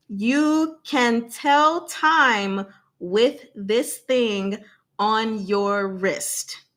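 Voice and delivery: very slow, deliberate clue-giving; speaking slowly. Shown as a demonstration. Host giving a one-syllable clue to chat, very slow. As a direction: stretch each word out so viewers catch every syllable. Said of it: "I'll speak slowly as well".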